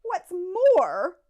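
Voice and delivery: loud, high angry snap; snapping. A narrator's reading. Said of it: "snapped the Lorax".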